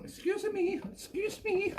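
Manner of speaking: high-pitched calling. Real, not acted. Host calling to the dog mid-show, high-pitched calling. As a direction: call out high to an unruly dog.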